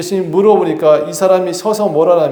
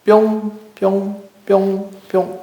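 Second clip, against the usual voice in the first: high, spaced-out "ppyong" pops; popping-up noise; 5 semitones higher, much slower than usual. Retold or imitated. Preacher showing Jesus suddenly appearing to people, high popping noises. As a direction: imitate someone popping up here and there. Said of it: "이래요".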